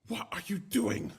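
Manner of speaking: reread, still upset and loud; upset wife. A narrator's reading. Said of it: "and asked".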